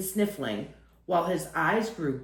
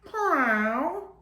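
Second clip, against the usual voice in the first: high, questioning meow, rising at the end; a cat asking for dinner at a closed door; 8 semitones higher than usual. Acted. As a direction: call through the door like a curious cat. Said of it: "trotted to the door of the other room and said".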